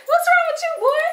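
laughing through the words, high and loud. Real, not acted. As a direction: burst out laughing as you ask. Laughing at silent boyfriend.

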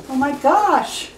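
high, slow laughing gush over puppies; delighted laugh. Real, not acted. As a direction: melt with a laugh at the cuteness. Cooing over cute puppies.

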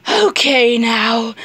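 ordinary delivery

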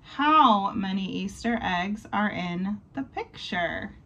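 usual speaking voice

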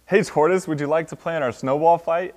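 high, excited hail; eager invitation. A narrator's reading. Squirrel inviting Tortoise to a snowball fight, eager. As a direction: call out like a keen playmate.

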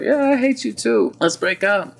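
high-pitched acted girlfriend voice; mock breakup retort. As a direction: squeal back the retort, petulantly. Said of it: "And she's like".

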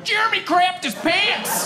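put-on high, slow kids' taunting chant; mock nine-year-olds shouting; kids yelling. Kids chanting at coach about teammate, high taunt. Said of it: "jumping around going".

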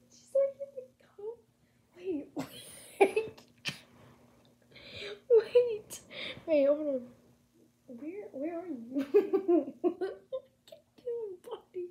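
high, loud laughing talk at the cat. Real, not acted. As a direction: giggle through the words, delighted.

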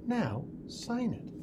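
soft, slow and gentle; quiet slow teacher instruction. Acted. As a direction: hand it over hushed and deliberate. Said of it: "quietly said".

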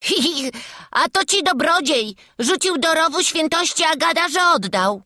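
high, loud snicker and jeer; scornful high-pitched child; mocking boy. A narrator's reading. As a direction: ridicule him as a cheeky lad. Boy jeers at prisoner.

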